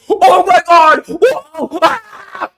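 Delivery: mock an influencer losing it over gameplay; shrieking fake excitement with a laugh; hyped influencer impression. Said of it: "An influencer is gonna do exactly what he does".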